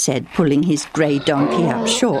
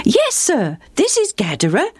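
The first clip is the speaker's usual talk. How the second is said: put-on higher boyish voice, eager and helpful; a cheerful lad keen to help; bright young boy; 8 semitones higher than usual. Goat-herding boy answering a traveller, bright higher boy voice.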